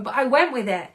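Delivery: ordinary talk, nothing marked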